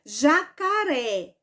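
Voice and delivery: very slow "Jacaré", long stressed "ré"; slow demonstration of "Jacaré", strongest on "ré"; stress on last syllable. Shown as a demonstration. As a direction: stretch "Jacaré" out, weight on the final "ré". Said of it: "O pedacinho mais forte foi o ré".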